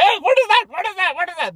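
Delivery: high, loud puzzled repeats, fading down; hammed-up bewilderment; mock confused woman.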